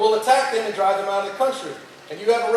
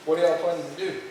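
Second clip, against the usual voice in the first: lightly voiced divine question, pitched down; God; 5 semitones lower, 4 dB quieter than usual. God questioning Shaquan about his house guests, lightly voiced and low. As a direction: ask it lower, as a low-key God. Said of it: "God comes to him and he goes".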